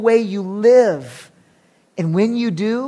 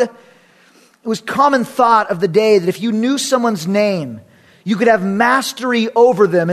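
usual speaking voice

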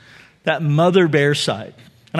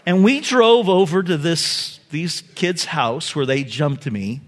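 ordinary talk

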